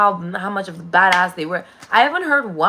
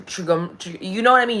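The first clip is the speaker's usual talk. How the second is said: usual speaking voice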